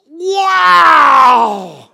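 huge, high, drawn-out exclamation; loud, stretched mock-amazed yell; amazed shout. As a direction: belt it out in exaggerated astonishment. Reacting as supposedly blind man walks away.

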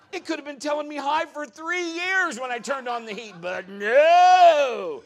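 high, loud comic rant; exasperated outburst. Retold or imitated. Preacher laments three years without heat, comic exasperation. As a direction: shout it, building to a huge drawn-out "no". Mock outrage at missed discovery.

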